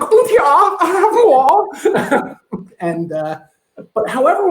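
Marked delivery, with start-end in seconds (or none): 0.0-2.4 put-on very high, loud German fat-kid voice; greedy German child, drawn out and squealing; German accent. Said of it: "when I do my German accent".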